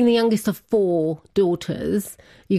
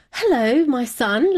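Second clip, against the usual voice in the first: mum's greeting; 7 semitones higher, slower than usual. Retold or imitated. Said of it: "like that".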